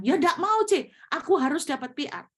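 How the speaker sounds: high, loud, stubborn protest; mock an angry student who won't give in; angry insistence. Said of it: "langsung marah-marah".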